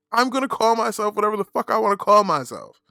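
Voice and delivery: loud, high-pitched insistence; defiant rant. Acted. Host telling critics he names himself, loud defiance. Rants back at imagined critics.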